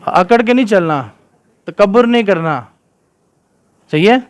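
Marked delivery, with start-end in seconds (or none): none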